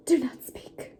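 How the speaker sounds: hushed unvoiced whisper. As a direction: whisper it dramatically. Hushed dramatic line.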